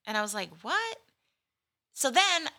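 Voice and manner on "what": mock surprise. Retold shock at cancellation.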